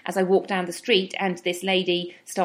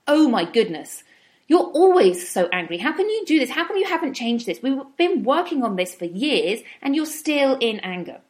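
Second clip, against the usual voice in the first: higher, louder mock scolding of yourself; self-scolding; 6 semitones higher, 4 dB louder than usual. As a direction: nag at yourself like a disappointed critic. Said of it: "That is not what I'm talking about".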